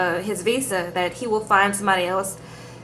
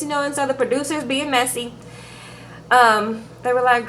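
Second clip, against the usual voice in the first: ordinary talk, nothing marked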